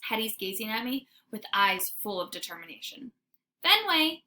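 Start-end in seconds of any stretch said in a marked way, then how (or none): 3.6-4.2 high, loud call to the dog; girl calling her dog to come. Acted. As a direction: call him over. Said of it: "she calls".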